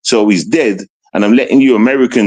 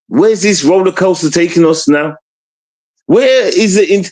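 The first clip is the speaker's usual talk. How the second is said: normal talking voice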